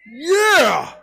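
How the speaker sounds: high, loud whooping yell; wild whoop. Acted. Preacher imitating wife speeding down a dirt road, wild whoop. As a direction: let out a huge "yeah".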